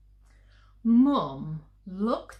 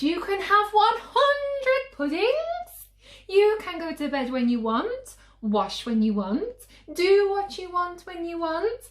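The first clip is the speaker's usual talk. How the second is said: much higher and louder, rushing the list; frantic mum; 10 semitones higher, 8 dB louder than usual. Acted. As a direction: let the bribes run wild. Mum's bribes get ridiculous.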